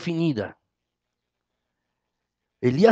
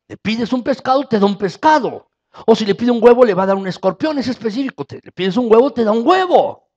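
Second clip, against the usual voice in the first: raised, higher, driving sermon voice; loud preaching climax; fervent preaching; 6 semitones higher, 7 dB louder than usual. Acted. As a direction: press the point home to the congregation. Preaching at full force.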